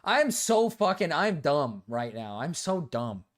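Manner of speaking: usual speaking voice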